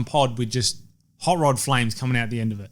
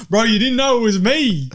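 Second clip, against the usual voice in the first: high, loud teasing squawk; mock gotcha voice; 11 semitones higher, 6 dB louder than usual. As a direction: ham up the big reveal. Mocking politician's hijab reveal.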